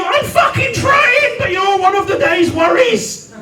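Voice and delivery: high-pitched frustrated yell; exasperated outburst. Acted. Sleepless man answering the meditation app, exasperated. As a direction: stretch the first words in exasperation.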